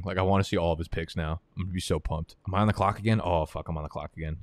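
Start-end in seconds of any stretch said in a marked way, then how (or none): none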